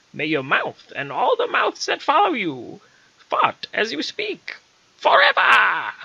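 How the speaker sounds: high, loud, slow witch voice cursing; witch curse. Retold or imitated. Witch cursing the general, high and shrieking. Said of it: "Then she said to me".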